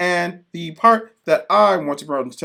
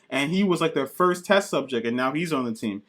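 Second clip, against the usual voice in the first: normal talking voice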